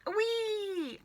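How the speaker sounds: high squealing whee; startled squeal as the medicine hits; yelled whee. A narrator's reading. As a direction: let a sudden jolted whee fly up. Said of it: "Grandma yelled".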